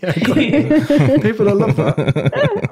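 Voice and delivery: high, slow, laughing through it. Real, not acted. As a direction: laugh out the words while teasing. Teasing a co-host's deep voice.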